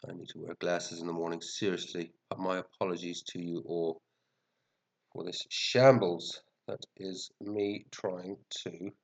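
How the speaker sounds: ordinary delivery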